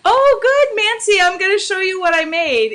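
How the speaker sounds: high, loud delighted hello; excited greeting. Real, not acted.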